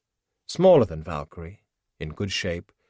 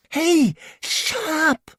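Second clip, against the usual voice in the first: much higher, louder, slow stretched shout; angry yell; 7 dB louder, much slower than usual. Acted. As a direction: bark him into silence. Tied up, yelling at singer.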